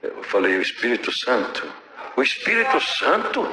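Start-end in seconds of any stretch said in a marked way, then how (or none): 2.2-3.5 higher, rising, disbelieving question; incredulous echo. Retold or imitated. Wife doubting her husband's answer, incredulous rising echo. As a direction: echo it back as if it can't be true.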